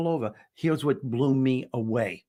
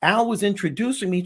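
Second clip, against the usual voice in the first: ordinary talk, nothing marked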